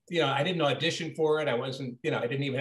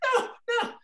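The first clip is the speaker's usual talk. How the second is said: much higher, panicked pleading cry; terrified woman. Retold or imitated. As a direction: beg in horror-movie terror. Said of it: "the poor woman is like".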